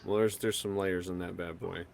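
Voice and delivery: usual speaking voice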